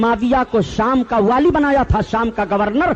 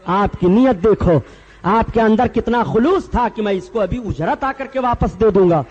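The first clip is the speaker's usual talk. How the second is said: normal talking voice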